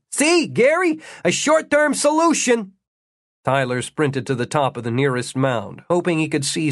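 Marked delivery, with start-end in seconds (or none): 0.1-2.7 high-pitched yell back over the shoulder; yelled out at a much higher pitch. A narrator's reading. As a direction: shout it to a friend behind you. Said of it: "looked back over his shoulder and yelled".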